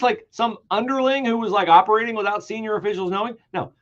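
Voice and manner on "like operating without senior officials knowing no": ordinary talk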